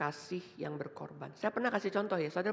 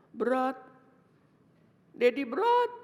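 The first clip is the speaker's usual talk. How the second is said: put-on high, loud child's complaint to daddy; whining five-year-old; 8 semitones higher, 6 dB louder, slower than usual. Five-year-old holding a Bible protests to father, whining on the verge of tears. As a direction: plead like a small child about to cry. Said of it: "Terus mulai nangis".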